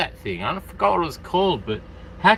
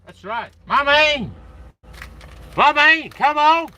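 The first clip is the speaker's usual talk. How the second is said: calling out; 10 semitones higher, 4 dB louder, slower than usual. Pretend. Calling out to friend.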